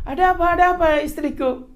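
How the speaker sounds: high, fast, worried question; alarmed husband. Retold or imitated. Husband rushes to screaming wife. (suami panik bertanya cepat dengan nada tinggi)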